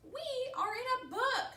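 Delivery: very high, loud delighted cry; Piggy thrilled to be in a book; excited squeal. Acted. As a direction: burst with joy. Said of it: "He is so excited".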